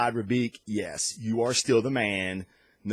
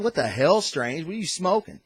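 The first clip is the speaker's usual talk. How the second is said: exasperated Carol; 7 semitones higher than usual. Retold or imitated. Carol mocking Strange's claim, high exasperated jab. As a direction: scoff at him, higher and higher. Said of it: "Carol has a great line going".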